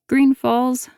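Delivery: said through a mouthful of chips; mouth full. Acted. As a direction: mumble around a mouthful. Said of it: "my mouth full of chips".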